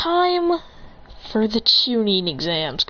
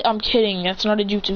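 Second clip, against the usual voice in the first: plain reading voice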